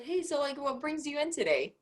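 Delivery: high, soft friendly host question; polite greeting. Shown as a demonstration. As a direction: ask it lightly like a welcoming host. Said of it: "you could have just said".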